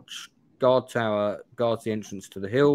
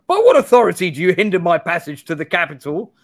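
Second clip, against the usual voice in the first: loud, higher, indignant challenge; haughty demand; 6 semitones higher, 9 dB louder than usual. Acted. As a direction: demand it as an offended noble. The rude option, defiant traveller.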